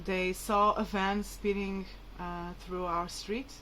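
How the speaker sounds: normal talking voice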